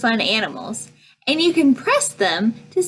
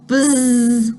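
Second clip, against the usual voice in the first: long held zzz; bee buzz; 3 dB louder than usual. A narrator's reading. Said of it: "Bees go".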